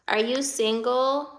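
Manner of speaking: normal talking voice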